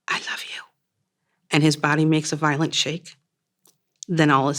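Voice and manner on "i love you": breathless dying whisper. Acted. Dying fiancé to his wife, faint whisper. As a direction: barely whisper the words. Dying man's last words.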